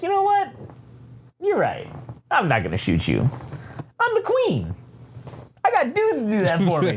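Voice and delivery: high, swooping queen voice; haughty queen. Acted. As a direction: let each short line swoop imperiously. Queen coolly answers, acted out.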